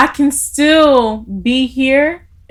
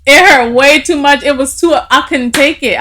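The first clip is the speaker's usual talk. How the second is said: teary laughter, much higher and louder; laughing through tears; 7 semitones higher, 11 dB louder than usual. Real, not acted. Recalling the mentor's call to her co-host, laughing, voice breaking high. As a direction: laugh while fighting back tears. Laughing while admitting she cried.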